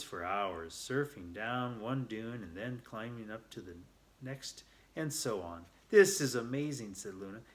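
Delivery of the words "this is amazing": louder, bright exclamation of delight; thrilled. A narrator's reading. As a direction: call it out in pure joy. Luna thrilled while sand surfing.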